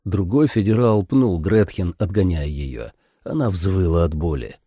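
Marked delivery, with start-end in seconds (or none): none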